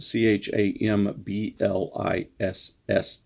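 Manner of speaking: ordinary delivery, nothing marked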